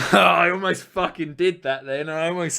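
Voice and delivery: laughing disbelief. Real, not acted. Streamer who almost did a Jamaican accent, laughing disbelief. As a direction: laugh as the words burst out.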